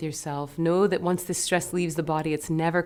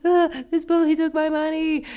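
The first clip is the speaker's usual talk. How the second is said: put-on much higher, louder sobbing little boy voice; hammed-up child crying out the news; mock crying boy; 11 semitones higher, 5 dB louder than usual. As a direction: wail it like a ten-year-old bursting into tears. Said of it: "bursting out into tears".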